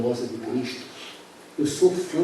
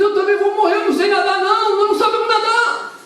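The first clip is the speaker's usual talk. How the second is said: high, loud, half-sung cry of fear; panicked wail; 7 dB louder than usual. Acted. Drowning boy cries to the fisherman that he cannot swim, high wail.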